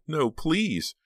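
high-pitched frightened plea; scared, pleading cry; terrified. A narrator's reading. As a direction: beg in dread.

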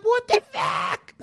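hammed-up shocked squeak; high mock disbelief. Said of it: "she's just like".

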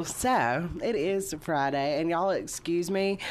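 ordinary talk

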